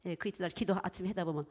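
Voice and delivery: usual speaking voice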